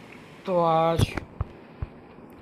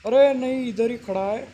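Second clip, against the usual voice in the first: surprised shout; 6 semitones higher, 6 dB louder than usual. Real, not acted. Grandpa still standing there.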